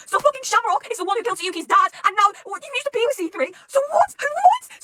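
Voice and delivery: loud, high, escalating disbelief; incredulous shock. Real, not acted. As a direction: climb to a squealed, baffled outburst. Reeling from the reveal.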